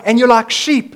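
loud, high, hammed-up coach voice; rally the troops like a cheesy motivator; mock upbeat announcement; pep-talk Jesus. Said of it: "worst motivational talk Jesus ever gave".

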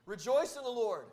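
"Rejoice in the Lord" projected up to the back row; lifted, carrying preacher's call. Shown as a demonstration. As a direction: call it out so the back can hear. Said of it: "I'm gonna say it again in case you didn't hear me in the back".

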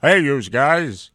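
very loud, slow character voice; put-on catchphrase voice. Actor saying the film line, booming put-on voice. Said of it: "You tried talking in that voice".

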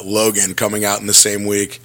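normal talking voice

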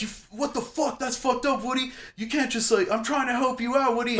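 very high-pitched, aggrieved protest; outraged, strained-high complaint; indignant yelling. Retold or imitated. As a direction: re-enact a drunk telling off a star. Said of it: "I just yell at him".

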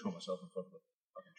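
hushed, fast mock whisper; whispered mock quote. Mocking the girl's threat.

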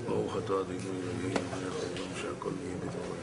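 very low, hushed recitation on one note; murmured blessing. Real, not acted. Speaker blessing before a drink, quietly murmured. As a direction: murmur the blessing under your breath.

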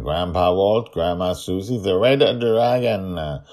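usual speaking voice

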